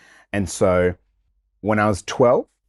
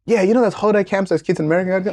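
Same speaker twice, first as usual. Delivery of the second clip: high, quick, coaxing mum voice; mum selling the camp; 9 semitones higher, faster than usual. Retold or imitated. Mum coaxing her son onto camp, in a high, quick voice. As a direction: sell it brightly and fast. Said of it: "it was like".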